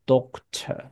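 "doctor" with a rounded O and no R at the end; British doctor. Shown as a demonstration. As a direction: say "doctor" the British way, ending in a plain schwa. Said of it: "英国人是会念doctor". (英式 doctor，O 念圆、不卷舌)

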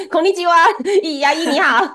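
high-pitched, playful hello; mock cheery greeting. Teacher jokingly greets a dentist, mock cheery greeting.

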